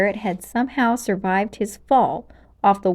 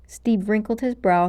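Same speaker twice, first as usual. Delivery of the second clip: plain reading voice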